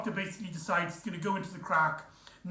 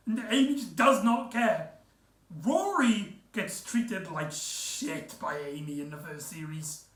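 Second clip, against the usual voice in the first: normal talking voice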